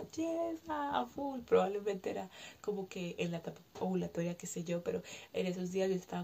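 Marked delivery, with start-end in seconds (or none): 0.0-1.4 excited high burst. Retold or imitated. To herself on her good days, a high excited cheer. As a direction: bounce up into a bright thrilled yes. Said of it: "habían tres días que yo estaba".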